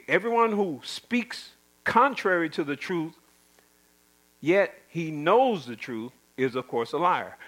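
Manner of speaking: normal talking voice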